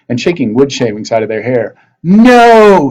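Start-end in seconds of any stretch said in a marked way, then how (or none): 2.0-2.9 loud, high scream of refusal. A narrator's reading. Sorceress screaming at the freed pirates, loud high scream. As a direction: shriek it out. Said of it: "she screamed".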